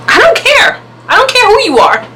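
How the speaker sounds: high-pitched, indignant brush-off; defiant. Retold or imitated. Storyteller dismisses the bad man's reputation, defiant. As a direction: refuse to be impressed, pitch leaping up.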